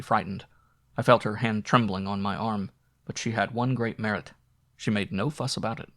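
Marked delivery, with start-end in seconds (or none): none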